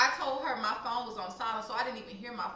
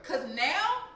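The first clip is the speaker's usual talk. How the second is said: ordinary talk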